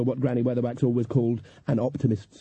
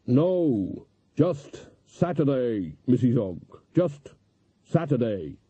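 slow, hollow undead drawl; zombie; much slower than usual. A narrator's reading. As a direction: speak like a weary corpse. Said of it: "Mister Zombie".

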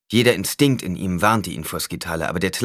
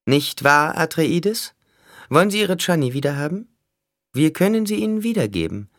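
high-pitched villain voice; bargaining Skitale, taunting; 9 semitones higher, 5 dB louder than usual. A narrator's reading. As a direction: speak high, pressing a deal. (hohe Figurenstimme, feilschend)

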